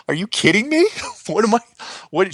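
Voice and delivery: high-pitched, broken-off disbelief with a laugh; retold incredulity. Son reacting to mom's impossible demand, retold incredulity. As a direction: balk at it, voice jumping up.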